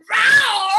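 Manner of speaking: loud, drawn-out roar of a planet name. Pretend. Alien introduces his home planet, loud roar. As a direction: roar it out like a wild cat.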